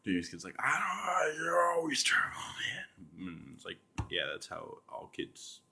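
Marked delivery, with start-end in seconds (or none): 0.6-2.9 put-on high, whining child protesting; whiny kid. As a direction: whine it like a kid who never gets a turn. Kid complaining about toy.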